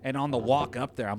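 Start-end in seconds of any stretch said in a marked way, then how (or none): none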